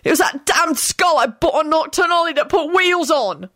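high, heated angry shouting. A narrator's reading. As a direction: shout back furiously in a high-pitched voice. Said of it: "William shouted".

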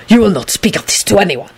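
restrained angry warning; furious hiss. A narrator's reading. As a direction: warn with contained fury. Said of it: "Dragomir hissed furiously".

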